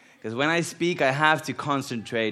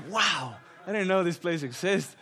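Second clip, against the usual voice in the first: delighted surprise; 7 semitones higher than usual. Retold or imitated. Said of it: "And like".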